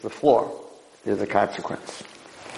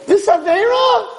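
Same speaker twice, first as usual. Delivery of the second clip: very high, ringing call; emphatic calling out; 3 dB louder than usual. Acted. Rabbi listing sins and their cleansers, high emphatic call. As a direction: cry out each item on the list. Preacher calling out each sin.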